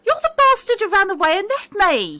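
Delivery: high, loud angry shout; enraged girl yelling; furious accusation. Acted. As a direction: scream it at him. Girl accuses him, acting as NPC.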